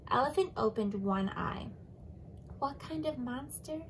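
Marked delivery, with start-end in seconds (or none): 2.6-3.8 drowsy, softer question; yawning. Acted. Sleepy Elephant asking Giraffe while yawning. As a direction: yawn out the words. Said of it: "he yawned".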